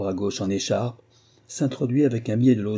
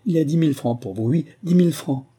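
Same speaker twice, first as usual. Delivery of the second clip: quickened, excited promise, climbing higher; eager offer; 4 semitones higher, 3 dB louder than usual. Acted. Sénart offers a reward for his casket, eager promise. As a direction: press the offer urgently.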